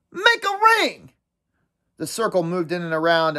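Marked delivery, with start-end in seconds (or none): 0.1-1.1 shouting. Acted. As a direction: call the gang into place. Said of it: "Jack shouted".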